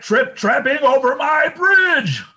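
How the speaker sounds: very high, loud troll shout; nasty troll shout. A narrator's reading. Troll shouts at the second goat, very high and loud. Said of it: "shouted the nasty troll".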